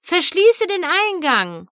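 urgent order. A narrator's reading. Friend calling an urgent order.

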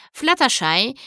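ordinary delivery, nothing marked

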